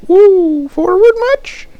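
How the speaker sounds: high, slow, teasing squeak; squeaky high voice. Retold or imitated. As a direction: tease in a tiny high voice. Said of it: "And I was like".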